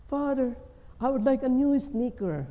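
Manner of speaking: put-on high, eager child's plea; child asking father. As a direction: play a little boy asking his dad for sneakers. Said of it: "you as a child, you go to the father".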